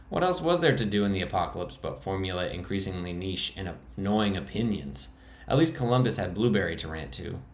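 usual speaking voice

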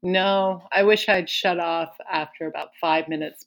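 normal talking voice

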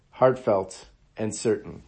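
ordinary talk, nothing marked

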